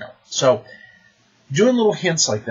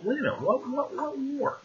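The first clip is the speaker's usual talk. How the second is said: high, soft, puzzled questioning; squeaky, hushed mock surprise; startled players; 14 semitones higher, 7 dB quieter than usual. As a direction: imitate players suddenly pricking up their ears. Curious players interrupt the demon.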